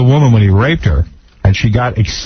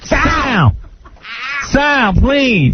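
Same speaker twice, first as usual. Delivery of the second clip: high, pleading imitation of a wife; mock mother; 12 semitones higher, much slower than usual. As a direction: beg the husband in a high whine. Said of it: "voicing Gary's mother".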